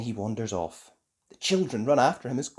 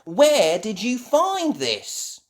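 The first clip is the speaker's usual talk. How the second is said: high, loud, slow question; curious; 9 semitones higher, 6 dB louder, much slower than usual. A narrator's reading. Ben asking Gaston about the object, curious. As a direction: ask with drawn-out wonder. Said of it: "looking at the object curiously".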